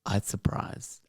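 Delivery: put-on very soft, whispered Polish-accent echo. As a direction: quietly try out a Polish accent. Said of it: "this is where I do the accent".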